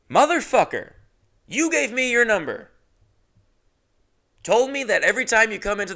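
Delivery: indignant rant; mock outrage. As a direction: rail at him in disbelief. Ranting at the texter.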